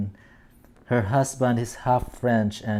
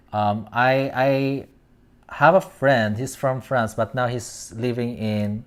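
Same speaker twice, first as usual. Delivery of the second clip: normal talking voice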